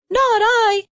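high, tiny mouse voice; squeaky mouse. A narrator's reading. Mouse refusing the hen, high and squeaky. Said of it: "said the mouse".

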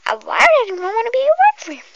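loud, high and fast scornful taunt; mocking loud jeer. Hairdresser mocks the customer's boyfriend loudly. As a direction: sneer it out loud and quick.

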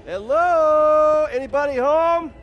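high, loud searching call; is-anyone-there shout. Acted. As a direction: holler into an empty building, drawing it out. Calling into an empty hideout.

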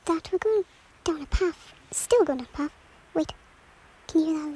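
ordinary talk

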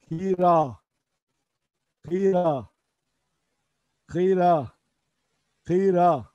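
kira said firmly, falling at the end; Urdu pronunciation. Shown as a demonstration. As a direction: model kira for repetition. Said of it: "diyoruz salatalık derken". (kira kelimesini net ve düşen tonla tekrarla)

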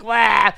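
loud, high wah yell; hammed-up maniac whoop; mock war cry. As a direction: let out a goofy battle cry. Maniac running with flamethrower.

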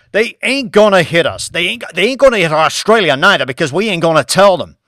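loud, high, furious leader voice; angry president. Acted. President yelling at Banks over starting a war, furious. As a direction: shout him down as an outraged head of state. President insists China won't strike.

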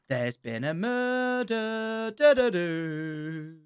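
very slow, hushed reveal after a gasp; mock dramatic reveal. Player finding a dead body, slow hushed mock drama. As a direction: draw it out softly, sung sting after. Spoofing murder line with sting.

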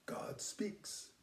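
hushed whisper of awe. Shown as a demonstration. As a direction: breathe it like a secret. Repeats phrase, then whispers it.

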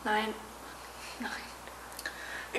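breathless unvoiced whisper. Wife whispers her denial to her husband. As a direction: barely breathe the word out.

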